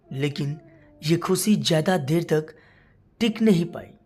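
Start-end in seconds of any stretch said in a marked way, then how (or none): none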